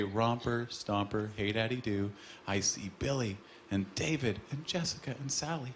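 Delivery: a hint of a soft, singsong kids' show host; children's TV host calling out names to viewers, lightly singsong. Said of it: "she would say".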